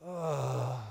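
low, soft groaning sigh; weary sigh. Shown as a demonstration. Israelites worn down by bondage, weary groaning sigh.

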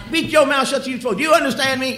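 high, loud demand; angry parent. Retold or imitated. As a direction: demand an answer sternly. Parent scolding child.

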